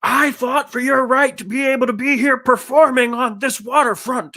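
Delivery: high-pitched imitation of an old conspiracy guy yelling; mock yelling. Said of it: "they're yelling back and forth at each other".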